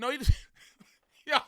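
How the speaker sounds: fast words breaking into laughter; laughing hard. Real, not acted. Host losing it laughing at the clip. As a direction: crack up mid-sentence.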